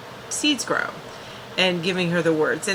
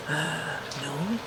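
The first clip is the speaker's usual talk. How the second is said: small, quiet, much softer reply; meek mumble; 4 semitones lower, 11 dB quieter than usual. Retold or imitated. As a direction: shrink away as you answer. Willow's meek answers to questioning.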